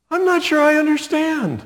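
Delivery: high-pitched, puzzled protest; baffled reply. Acted. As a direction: squeak out bewilderment at God's answer. Acted baffled reply to God.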